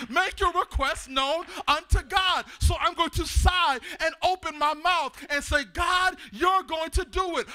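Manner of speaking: loud, driving sermon delivery; full-force preaching. Real, not acted. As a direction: preach it hard to the congregation.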